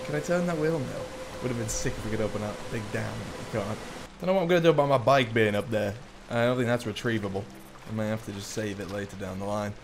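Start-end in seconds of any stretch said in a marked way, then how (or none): none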